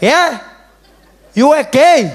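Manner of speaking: loud, high, startled uncle; surprised uncle. Retold or imitated. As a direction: react like a shocked old uncle. Uncle voiced in story.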